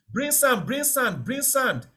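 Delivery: high calling cry of bring sand, repeated; retold shout. Bystander at a fire calling for sand, mock shouting. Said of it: "somebody shouting".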